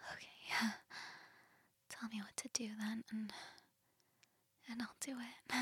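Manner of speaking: soft intimate whisper. Acted. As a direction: breathe it close and quiet.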